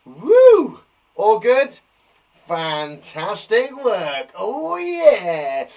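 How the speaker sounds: high, loud whoop of joy; cheer. Real, not acted. Teacher cheering pupils after the spelling test, excited whoop. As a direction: cheer the class on.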